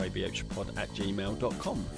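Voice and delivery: ordinary talk, nothing marked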